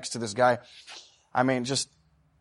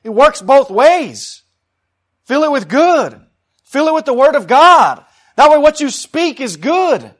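impassioned preaching; 10 semitones higher, 11 dB louder than usual. Acted. Preacher urging people to fill up with good, impassioned preaching. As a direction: preach it out forcefully to the congregation.

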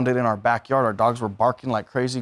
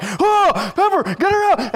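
much higher, louder alarmed shout; yelling at Pepper to drop the bird; retold shout at dog; 6 dB louder, slower than usual. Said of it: "we were like".

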